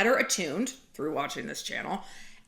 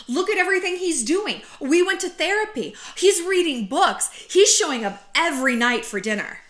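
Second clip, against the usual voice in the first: higher, louder bragging; defensive girlfriend voice; 6 semitones higher, 4 dB louder than usual. Acted. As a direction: show him off to friends.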